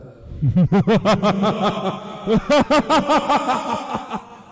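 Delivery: villain laugh. Acted. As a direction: cackle like a gloating overlord. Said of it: "I wanna do the laugh".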